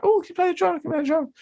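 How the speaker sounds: very high squeaky girly mock voice; mock the wedding girls pestering the drummer. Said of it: "these girls coming up".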